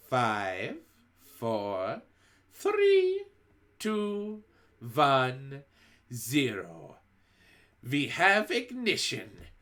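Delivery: shifting witch voice, slow and gleeful at ignition; witch countdown. A narrator's reading. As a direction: count down like a launch.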